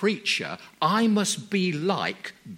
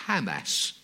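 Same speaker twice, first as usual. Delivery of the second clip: ordinary delivery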